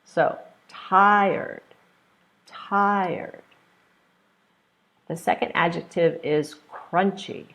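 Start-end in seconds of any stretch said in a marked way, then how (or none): 0.7-3.4 TIRED with a higher, drawn-out TI; tired, pitched up front, then falling; first-syllable stress. Shown as a demonstration. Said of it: "stress the first one".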